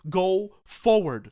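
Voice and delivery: loud, slow, drawn-out command; booming slow exhortation; preaching shout. Acted. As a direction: hammer it home from the pulpit. Preaching at full force.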